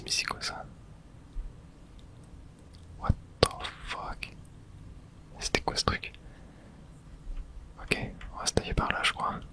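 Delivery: normal talking voice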